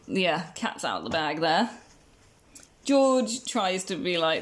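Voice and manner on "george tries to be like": ordinary talk, nothing marked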